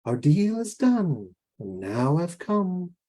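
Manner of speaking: slow, high sing-song menace; dark and sweet. Acted. Giant bird come to collect on a deal, dark and sweet. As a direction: draw it out sweetly, swooping in pitch. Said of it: "dark and sweet".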